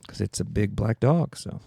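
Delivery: ordinary talk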